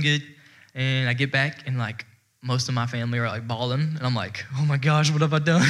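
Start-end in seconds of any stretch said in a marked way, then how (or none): 4.6-5.7 louder, dismayed and breaking into laughter; mock alarm. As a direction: blurt it out, about to laugh. Mock alarm at family's tears, laughing.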